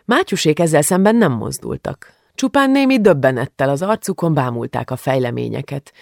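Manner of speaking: ordinary delivery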